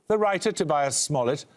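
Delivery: normal talking voice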